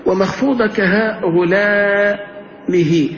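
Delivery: slow, drawn-out chanted recital; slow classical verse recitation; reciting a grammar verse. Shown as a demonstration. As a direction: recite the line like a memorised verse. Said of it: "quotes grammar verse".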